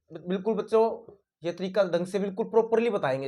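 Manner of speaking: usual speaking voice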